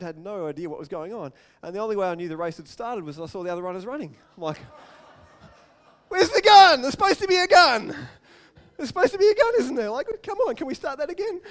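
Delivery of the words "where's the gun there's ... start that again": much higher and louder, baffled and pleading; confused kid asking where the gun went; bewildered outcry. Retold or imitated. Young runner at the blocks missing the starting gun, baffled outcry.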